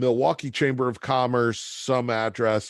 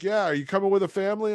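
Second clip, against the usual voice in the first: much higher, chipper salesman voice; cheery Joe; 9 semitones higher, much faster than usual. Retold or imitated. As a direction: sell Milwaukee like a booster. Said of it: "He's like".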